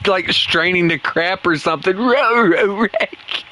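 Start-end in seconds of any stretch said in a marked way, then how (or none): 2.0-3.4 higher, strained cartoon dog voice; Scooby-Doo. Gamer narrating his mistakes as a cartoon dog, strained and higher. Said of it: "Scooby-Doo impression".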